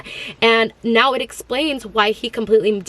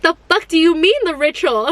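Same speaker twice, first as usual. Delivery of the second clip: retelling a stunned reaction, pitched way up; shocked disbelief; 7 semitones higher than usual. Retold or imitated. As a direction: blurt it out high in shock. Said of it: "and I was like".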